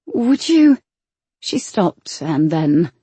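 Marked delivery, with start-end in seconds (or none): 0.1-0.8 heroine voice. A narrator's reading. Heroine's hesitant question.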